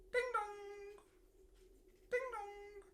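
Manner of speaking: very high sung ding dong; doorbell chime. A narrator's reading. Snuggly presses Giggly's doorbell, high chime.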